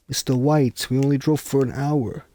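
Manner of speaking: bad Jesse impression. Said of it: "It sounds nothing like Jesse".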